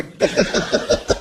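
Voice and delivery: soft, voiceless chuckling; a run of quiet laughs at your own joke; chuckle. Real, not acted. Preacher after teasing his listeners about looks, soft chuckle.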